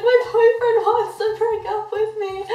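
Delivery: high, teary wail through laughter; half-laughing, half-sobbing the bad news; mock crying. Telling coworkers the breakup news, mock tearful wail. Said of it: "I was crying".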